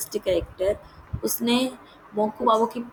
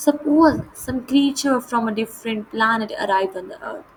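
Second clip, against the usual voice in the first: measured, slow read-aloud of the English text; deliberate storybook narration; slow story reading; much slower than usual. A narrator's reading. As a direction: read each phrase out slowly and clearly, like a lesson passage.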